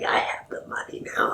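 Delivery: hushed imitation of a villain; mock-villain whisper; sly whisper. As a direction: whisper it like a secret gloat. Acts Potter secretly gloating.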